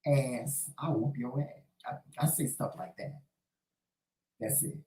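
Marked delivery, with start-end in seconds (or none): 0.1-2.0 hushed cussing. Retold or imitated. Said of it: "But I say things like".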